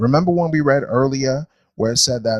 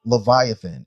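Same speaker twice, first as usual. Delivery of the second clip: slow, deliberate verse reading aloud; scripture reading; 3 semitones higher, slower than usual. Acted. Teacher reading creation verses to a class, measured scripture reading. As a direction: read it out solemnly, phrase by phrase.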